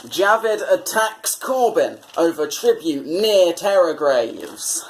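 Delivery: ordinary talk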